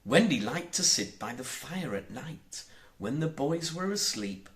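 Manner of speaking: ordinary delivery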